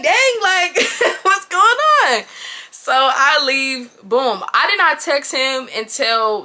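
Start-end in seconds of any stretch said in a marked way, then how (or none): 0.0-2.2 high amazed. Retold or imitated. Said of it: "I'm just like".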